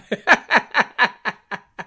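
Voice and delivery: loud laughing burst; real laugh. Real, not acted. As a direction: break into a laugh. Laughing at pilgrims dance.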